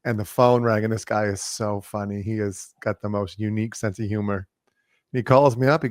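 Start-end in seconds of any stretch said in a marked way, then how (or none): none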